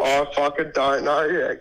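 ordinary delivery

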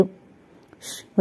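a sharp whispered shush; urgent shush. Acted. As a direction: hush her fast, breath only. Hari hushes Roja urgently. (గుసగుసగా ష్ అని ఆపడం)

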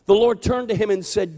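ordinary delivery

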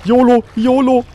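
loud, high battle cry; hyped shout. Real, not acted. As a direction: psych yourself up and go. Charging in to the danger.

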